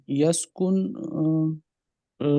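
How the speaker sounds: normal talking voice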